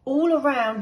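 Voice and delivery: usual speaking voice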